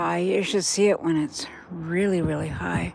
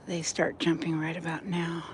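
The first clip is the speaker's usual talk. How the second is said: whisper; 7 dB quieter than usual. Real, not acted. Whispering by the river.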